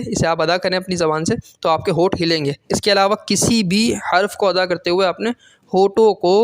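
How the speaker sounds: normal talking voice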